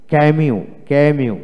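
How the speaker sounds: usual speaking voice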